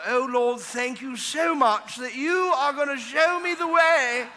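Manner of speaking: mock showy prayer. Preacher mocking a flowery prayer, high and slow. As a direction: pray it like a pompous churchgoer. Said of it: "prayer coming up here in Elizabethan".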